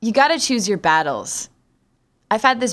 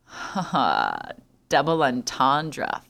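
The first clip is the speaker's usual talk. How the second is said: ordinary delivery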